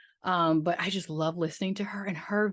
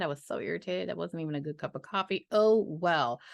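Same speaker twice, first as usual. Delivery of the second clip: normal talking voice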